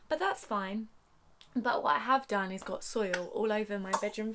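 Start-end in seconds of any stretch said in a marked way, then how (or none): none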